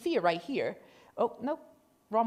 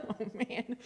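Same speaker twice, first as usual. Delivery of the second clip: disappointed sigh; 11 dB quieter than usual. Retold or imitated. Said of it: "I was like".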